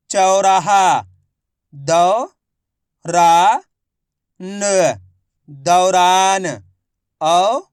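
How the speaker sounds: loud, slow, syllable-by-syllable reading drill; a teacher sounding out Hindi words letter by letter. Shown as a demonstration. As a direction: drill each letter aloud for the class. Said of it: "अब आगे देखिए".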